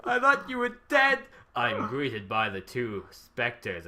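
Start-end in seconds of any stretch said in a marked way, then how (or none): 0.0-1.2 bubbly high-pitched character voice; high excited girl voice. Acted. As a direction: squeal it with delight.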